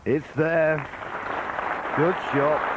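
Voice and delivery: excited. Real, not acted. Commentator reacts to pot.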